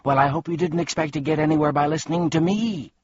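high, fast gloating; gleeful. A narrator's reading. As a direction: crow with mischievous glee. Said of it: "said the voice gleefully".

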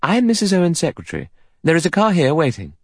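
high, efficient introduction; businesslike, pitched well up; competent. A narrator's reading. Secretary greets the arriving guest, competently. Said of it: "in a competent manner".

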